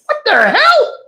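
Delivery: very high shocked cry; startled. Retold or imitated. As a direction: jump at the noise. Startled reaction.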